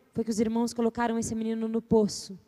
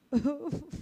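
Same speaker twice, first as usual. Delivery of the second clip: a crying giggle; half cry, half laugh; 8 dB quieter than usual. Shown as a demonstration. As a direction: sob into a laugh. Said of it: "Choro risada".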